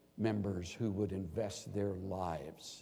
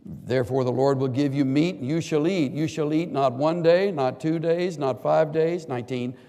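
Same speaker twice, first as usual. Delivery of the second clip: loud, emphatic preaching; loud and rising toward the final line; full-force preaching; 5 semitones higher, 11 dB louder than usual. Acted. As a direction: thunder out God's promise. Reading God's answer at full force.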